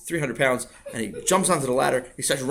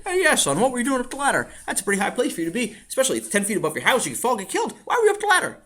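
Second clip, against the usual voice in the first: higher, brisk fireman voice; joking fireman; 9 semitones higher, faster than usual. Acted. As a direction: scold the boy half jokingly. Fireman scolds Sammy.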